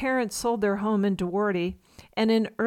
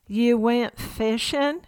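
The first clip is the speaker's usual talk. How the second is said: Ma mishearing, slow and drawn-out in Southern twang; slow puzzled Southern question; Southern accent; 3 semitones higher, slower than usual. Retold or imitated. Ma mishears Pa, asking slowly in a mock Southern accent. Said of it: "in their strongest Southern accent".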